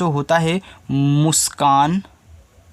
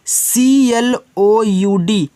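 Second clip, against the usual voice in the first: very slow letter-by-letter spelling, higher and louder; सी एल ओ यू डी drawn out for children to follow; 5 semitones higher, 3 dB louder, much slower than usual. Shown as a demonstration. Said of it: "इसको पढ़ते हैं cloud". (ऊँची आवाज़ में धीरे धीरे spelling)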